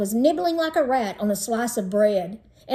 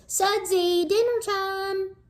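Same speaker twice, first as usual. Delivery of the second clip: higher sing-song call to dinner; mother calling; 9 semitones higher, slower than usual. Acted. Mother calling her son to dinner, sing-song. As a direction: call him to the table in a lilting tune. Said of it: "My mother's voice".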